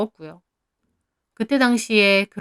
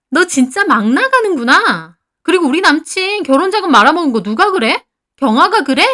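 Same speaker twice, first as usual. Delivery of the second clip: high, loud, affronted fury; heated, raised-pitch indignation; outraged confrontation; 7 semitones higher, 7 dB louder than usual. A narrator's reading. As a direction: demand to know who told. Friend enraged at exposed secret.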